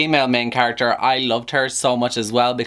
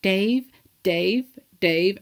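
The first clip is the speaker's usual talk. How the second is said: higher squeaky chipmunk calling a name over and over; Theodore chipmunk voice; 7 semitones higher, much slower than usual. Retold or imitated. Retelling the friend repeating it, high chipmunk voice.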